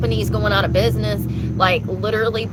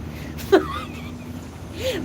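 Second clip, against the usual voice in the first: high embarrassed laugh; 5 dB quieter than usual. Real, not acted. Embarrassed laugh after hitting kid.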